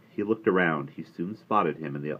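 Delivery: usual speaking voice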